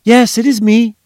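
high, loud cry of triumph; triumphant shout. A narrator's reading. Mother hearing her child's voice, shouting triumphantly. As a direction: call out in joyful relief. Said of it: "she shouted triumphantly".

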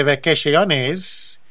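drawn-out mock Spanish vacaciones; slow mock Spanish. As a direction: say vacaciones slowly in a put-on Spanish accent. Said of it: "as they say".

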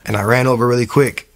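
ordinary talk

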